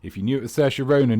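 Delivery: ordinary talk, nothing marked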